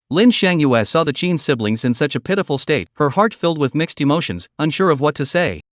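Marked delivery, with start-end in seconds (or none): none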